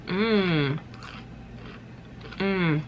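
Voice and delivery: humming with mouth full; tasting while chewing; chewing a chip. Real, not acted. Seen 0: Bit chip at -4; closed lips shift while she hums.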